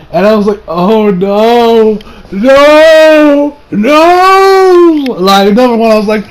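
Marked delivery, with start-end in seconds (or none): 0.7-5.1 retold wail climbing higher and louder; hammed-up drawn-out cry of dismay; each no bigger and higher; anguished no. Acts out own anguished reaction.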